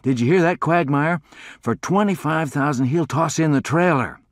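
high, loud eager announcement; thrilled calling out; excited call. Acted. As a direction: tell your friend the great news loudly. Calls friend over, thrilled.

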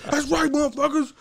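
high, brash jailhouse taunt; hammed-up inmate voice; mocking inmate bully. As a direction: lord it over the kids. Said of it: "He's like".

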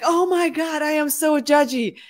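high, loud exclamation of dismay; acted-out self-accusing outburst; dismayed confession. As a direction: confess it in mock horror. Acting out her confession in class.

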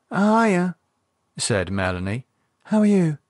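put-on chirpy teenage hiya; teen greeting. Melanie greeting the older couple, bright teen voice.

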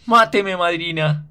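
high, very loud, crazed scream; frantic scream. A narrator's reading. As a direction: scream it out of your mind with despair. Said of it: "grita enloquecido".

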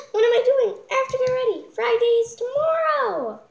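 high, loud, fast panicked squeal; frantic panic. Acted. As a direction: blurt it in a frantic rush. Panicked rush to get ready.

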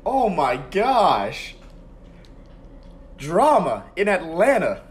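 high, loud, slow exclaim; slow thrilled commentator call; dramatic awe. Real, not acted. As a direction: savor the drama like an announcer. Kick hits the crossbar.

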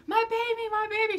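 very high imitation of a panicked mom; fretting motherly voice. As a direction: imitate a mother fussing over her child. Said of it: "had that little motherly instinct".

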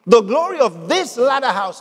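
"this" punched above the other words; stress on THIS. Shown as a demonstration. Preacher correcting a common misreading, stress on "this". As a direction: hit "this" hard in "this latter house". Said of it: "I emphasized the word "this".

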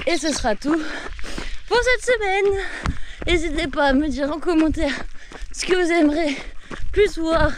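while running. Real, not acted. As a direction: push the words out between strides. Still running, on the way back. (en courant, voix plus haute et plus forte)

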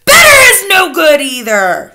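high, very loud witch shriek. A narrator's reading. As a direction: shriek it down at the audience. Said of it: "shrieked the Grand High Witch".

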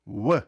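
В said as a w, lips rounded and open, not pressed; w without closed lips. Shown as a demonstration. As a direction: blow the В out without closing the lips. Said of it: "не закриваємо губи на звук В".

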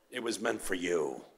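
put-on low, soft, tender Italian accent. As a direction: imitate an Italian father reassuring softly. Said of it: "he said in his Italian accent".

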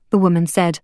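ordinary delivery

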